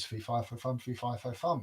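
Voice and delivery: low-key, soft chant; muttered ogre's refrain; receding giant. A narrator's reading. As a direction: chant it quietly as the giant heads off.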